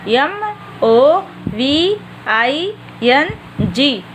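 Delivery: each letter drawn out slowly; slow spelling. Shown as a demonstration. Teacher dictating a spelling to young students, slow and deliberate. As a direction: spell it out one letter at a time for the class. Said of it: "एक बार फिर से देख लीजिए बेटा".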